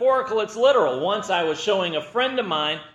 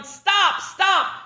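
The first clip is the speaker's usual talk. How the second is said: high, loud panicked yells; mock-panicked yelling at a runaway horse; yelling stop; 11 semitones higher, 4 dB louder than usual. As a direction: yell at the horse to halt. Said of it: "the man starts yelling".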